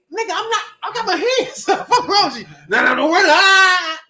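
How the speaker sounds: high, loud frantic pleading; hammed-up terrified protest; mock panic. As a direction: act scared with your hands up. Retold panic at police jump-out.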